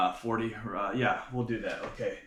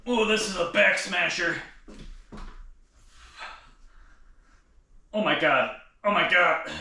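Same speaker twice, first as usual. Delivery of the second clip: higher and louder, forced out under heavy effort; straining; 7 semitones higher, 5 dB louder than usual. Real, not acted. Lifter groaning to viewers under a back smasher, straining. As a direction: push the words out mid-lift.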